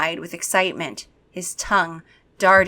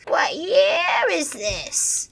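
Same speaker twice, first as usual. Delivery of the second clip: high, slow vampire voice; lightly eerie; vampire inspects the kids' clothes; 9 semitones higher, much slower than usual. Acted. Vampire asks.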